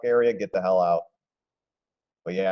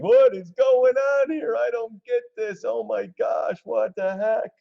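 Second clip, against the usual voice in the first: much higher, slow, bewildered mock voice; mock confused trader; 12 semitones higher, slower than usual. Streamer imitates baffled traders as the stock drops, high panicky mock voice. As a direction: play the panicked trader who doesn't get it.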